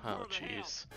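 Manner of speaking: normal talking voice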